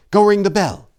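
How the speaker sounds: high, loud urgent order; urgent call. A narrator's reading. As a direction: hurry the brother to the bell. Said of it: "Daneth said".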